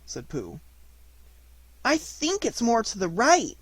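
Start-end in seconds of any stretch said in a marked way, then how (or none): 1.8-3.6 slow, timid, high little suggestion; nervous. A narrator's reading. Piglet unsure of the way in the mist, nervous. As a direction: suggest it hardly daring to. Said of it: "said Piglet nervously".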